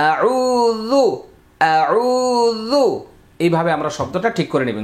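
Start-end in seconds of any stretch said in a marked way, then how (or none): 0.1-2.8 আউযু chanted very slowly with the ও vowel stretched one alif long; drawn-out recitation. Shown as a demonstration. Teacher modelling for students, slow stretched আউযু. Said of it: "ঠোঁট গোল হবে".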